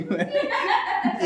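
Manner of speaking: a high embarrassed laugh. Real, not acted. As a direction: burst out laughing. Laughing at bare cheeks.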